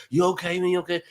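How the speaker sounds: high-pitched imitation of worried bystanders; concerned onlookers. Crowd checking on a supposedly rescued swimmer, high mock concern.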